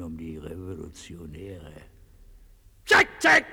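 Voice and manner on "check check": sharp, very high, loud shout. Acted. Dictator breaking off his speech, sharp shout. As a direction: fire it out like a squawk.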